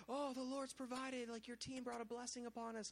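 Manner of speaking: mock-reverent villager praising the Lord; grateful locals. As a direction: gush thanks like a villager who was just blessed. Locals praising God, voiced.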